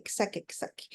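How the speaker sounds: whispering to let grief out; whispered. Shown as a demonstration. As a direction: fade the repeat into a whisper. Repeating "let them get it out.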